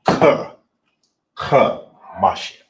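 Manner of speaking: Ka punched high, loud and breathy, repeated, then Mashim; emphasis on KA. Shown as a demonstration. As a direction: drive the Ka home twice. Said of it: "You wanna make sure you put emphasis".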